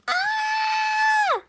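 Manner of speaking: long high-pitched shriek; piercingly high, sustained "aah" scream. A narrator's reading. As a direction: shriek out in dismay. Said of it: "shrieked Peter".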